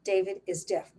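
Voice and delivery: normal talking voice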